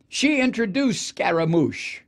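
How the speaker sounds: usual speaking voice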